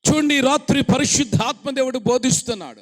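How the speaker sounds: loud, high proclamation; preaching burst. Acted. Preacher proclaiming to the congregation, loud and high. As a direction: announce it to the whole hall.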